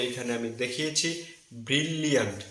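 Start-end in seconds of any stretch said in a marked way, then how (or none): 1.7-2.4 ব্রিলিয়ান্ট as bril-liant, L in both syllables; correct pronunciation. Shown as a demonstration. Teacher showing learners the word, bril-liant with doubled L. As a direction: hold the L in ব্রিলিয়ান্ট. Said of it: "সঠিক উচ্চারণ হবে".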